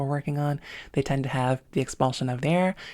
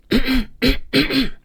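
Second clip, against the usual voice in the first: high, bright louder cough; higher, brighter cough; 10 semitones higher, 8 dB louder than usual. Shown as a demonstration. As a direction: lift the cough up and make it bright. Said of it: "it's a little bit higher for me".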